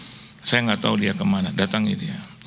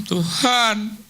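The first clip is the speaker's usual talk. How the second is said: mock prayer; 9 semitones higher than usual. Quoted prayer to God.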